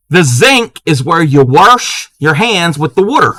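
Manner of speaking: Maryland "zank", "warsh" and "wooter"; Maryland accent. Shown as a demonstration. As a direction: demonstrate a thick Maryland accent. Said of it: "I don't have the accent".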